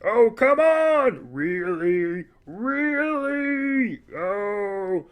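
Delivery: high, loud, drawn-out whine; Ray Romano. Acted. Streamer impersonating a sitcom star or henchman, drawn-out whine. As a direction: stretch every word in exaggerated protest. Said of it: "Ray Monano from Everyone Loves Raymond".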